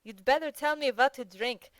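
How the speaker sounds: Petritsky, hungover. A narrator's reading. Hungover Petritsky asks Yashvin.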